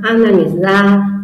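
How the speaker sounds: ordinary talk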